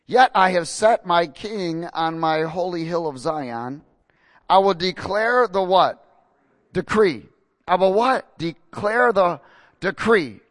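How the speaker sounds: loud, slow, prompting the congregation to answer; call-and-response reading. Acted. Preacher leads the church to answer "decree". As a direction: pause and pull the word "decree" out of them.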